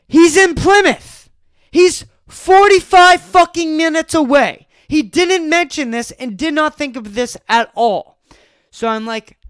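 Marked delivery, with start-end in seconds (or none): 0.1-7.0 raised, loud disbelief; exasperated outburst. Real, not acted. Ranting to listeners about his friend, loud exasperated outburst. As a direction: blurt it out louder and higher, fed up.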